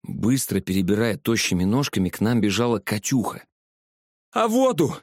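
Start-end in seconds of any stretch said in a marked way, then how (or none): 4.3-5.0 high breathless cry while running; breathless shout. A narrator's reading. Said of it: "задыхаясь, кричала девчонка". (запыхавшийся крик на бегу)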